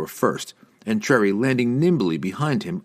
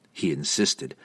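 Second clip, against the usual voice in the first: ordinary delivery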